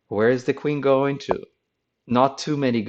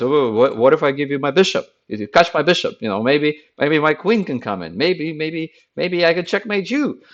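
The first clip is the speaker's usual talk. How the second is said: higher, louder, rushed panicky bargaining; mock panic; 6 semitones higher, 4 dB louder than usual.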